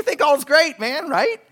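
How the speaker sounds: mock self-satisfied swagger; smug carefree. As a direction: play the careless worldly guy. Mocking carefree sinner.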